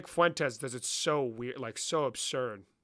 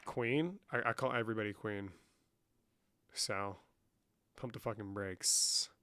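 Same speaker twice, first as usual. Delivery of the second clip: usual speaking voice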